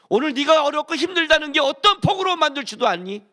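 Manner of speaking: high, loud booming voice of God; God's thundering rebuke. Retold or imitated. Preacher voicing God rebuking Job, a booming high shout. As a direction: hurl the questions down at Job from on high. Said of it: "이렇게 말하는 거예요".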